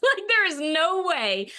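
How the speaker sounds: loud, high-pitched protest; emphatic refusal. Real, not acted. Woman swearing off sarcastic men on dating sites, emphatic refusal.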